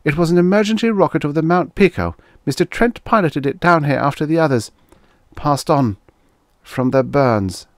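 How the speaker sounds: put-on high-pitched voice of a hurt girl; girl's voice. A narrator's reading. Stranded girl explains the rocket landing, high-voiced. Girl in story answers Guthrie.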